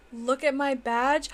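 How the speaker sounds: ordinary delivery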